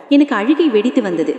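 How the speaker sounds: plain reading voice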